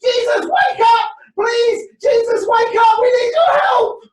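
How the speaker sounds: high, loud panicked yelling; panicked shout. Acted. Frightened disciple shouting to wake Jesus in the storm, high panicked yells. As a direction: scream in terror over the storm.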